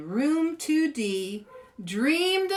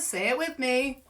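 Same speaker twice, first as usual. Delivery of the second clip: ordinary delivery, nothing marked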